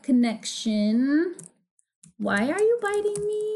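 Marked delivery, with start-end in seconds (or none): 2.2-3.6 high, plaintive aside; high-pitched baffled complaint; pained whine. Real, not acted. Reacting to being bitten mid-recording.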